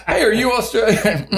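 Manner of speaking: high giggling question; laughing. Real, not acted. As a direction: squeal it through laughter.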